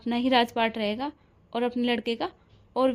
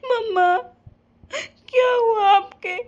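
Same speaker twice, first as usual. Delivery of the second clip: crying and pleading; 4 semitones higher than usual. Acted. A daughter sobbing and begging her unconscious mother to wake up.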